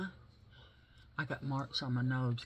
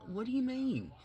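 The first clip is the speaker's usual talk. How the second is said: retold alarm; 13 semitones higher than usual. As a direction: squeak it out in disbelief. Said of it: "I said".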